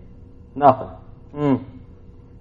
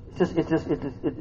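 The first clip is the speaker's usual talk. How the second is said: usual speaking voice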